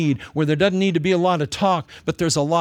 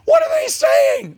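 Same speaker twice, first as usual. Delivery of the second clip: shocked Paul; 6 dB louder than usual. Acted. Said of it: "Paul would go".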